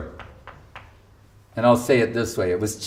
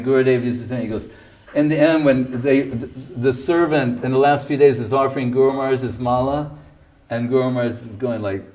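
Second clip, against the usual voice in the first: ordinary delivery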